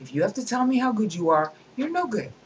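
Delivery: normal talking voice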